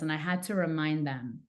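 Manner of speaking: ordinary talk, nothing marked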